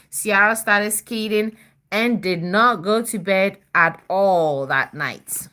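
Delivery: usual speaking voice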